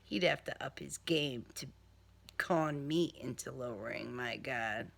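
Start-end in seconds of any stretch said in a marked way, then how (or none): none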